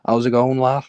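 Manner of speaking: mock Liverpool accent; Scouse accent. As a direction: greet them like a Liverpool lad. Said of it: "doing an accent for no".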